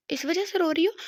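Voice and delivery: surprised question. Acted. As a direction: disbelief that this is why she cries. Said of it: "Afnan ने हैरानी से कहा।".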